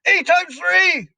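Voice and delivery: very high, loud drilling; furious shout. A narrator's reading. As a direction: bellow the sums at a terrified pupil. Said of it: "the Trunchbull shouted".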